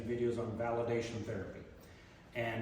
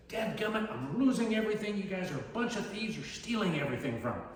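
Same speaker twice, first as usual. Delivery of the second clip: indignant accusation; 5 semitones higher than usual. Retold or imitated. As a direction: accuse them like a cranky old resident. Resident accusing staff of stealing.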